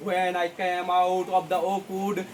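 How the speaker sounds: slow, soft, drawn-out verse; slow poem recitation. Shown as a demonstration. Teacher reading the poem to students, slow and soft. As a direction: read it softly and very slowly. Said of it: "এবার কবি বলছেন".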